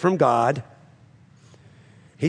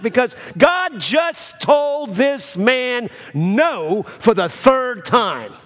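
rising, louder preaching push; fervent preaching; 7 semitones higher, 4 dB louder than usual. Acted. Pastor rousing the congregation, fervent preaching. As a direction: preach it hard to the church. Preacher builds to full force.

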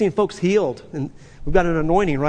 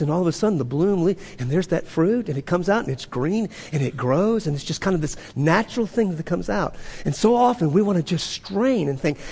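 ordinary talk, nothing marked

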